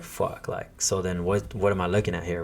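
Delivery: whispered under the breath; hushed dismay. Retold or imitated. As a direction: mutter it to yourself, crushed. Dismay at repair cost.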